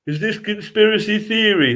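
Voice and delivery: imitating a dopey local in a Yorkshire accent; Yorkshire dialect. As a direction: put on a broad Yorkshire voice. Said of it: "That's how they talk around, around here".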